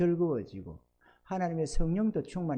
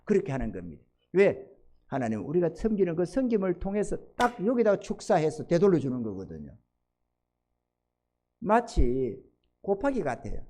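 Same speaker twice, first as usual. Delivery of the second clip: ordinary talk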